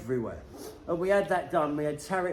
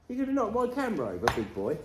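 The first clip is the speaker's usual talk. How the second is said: talking to the dog; 8 semitones higher, faster than usual. Real, not acted.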